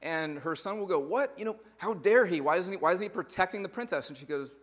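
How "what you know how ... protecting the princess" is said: high, loud mock outrage of a child; indignant kid. Boy outraged that the prince harms the princess, playful high voice. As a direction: squeal the kid's shock, lightly hammed.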